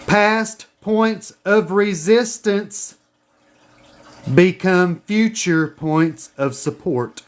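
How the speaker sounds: slow, deliberate dictation; dictation pace. Shown as a demonstration. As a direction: say it slowly for them to write down. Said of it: "Write this down".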